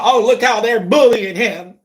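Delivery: high, whimpering mock outrage; mock-crying sarcastic imitation; mocking whiny critic.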